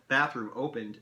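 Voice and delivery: plain reading voice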